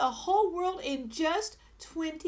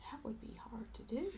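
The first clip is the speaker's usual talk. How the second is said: sigh, then a fast breathy whisper; a quiet aside to the listener; 5 semitones lower, 13 dB quieter, faster than usual. Real, not acted. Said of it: "reader's whispered aside".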